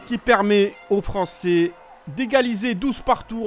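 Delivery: loud, high, stretched try call; excited sports commentary. Real, not acted. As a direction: belt out the equalising try. French try scored, live call.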